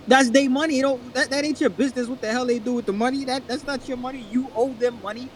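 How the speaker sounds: high-pitched indignant insistence. Real, not acted. Arguing that it ain't your business, indignant. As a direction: argue it heatedly, pitched well up. Indignant argument over others' money.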